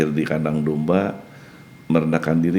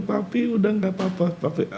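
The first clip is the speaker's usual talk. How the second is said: daughter's voice; 4 dB quieter than usual. Retold or imitated. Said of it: "Dia bilang".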